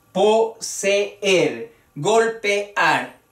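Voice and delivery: "poseer, golpear" in separate syllables, po-se-er, gol-pe-ar; hiato marcado. Shown as a demonstration. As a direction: pull the two vowels apart in "poseer, golpear". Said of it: "así como debía pronunciarse el hiato". ("poseer, golpear" con las dos vocales separadas)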